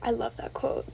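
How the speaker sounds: normal talking voice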